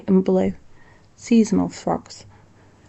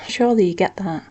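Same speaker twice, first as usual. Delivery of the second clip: plain reading voice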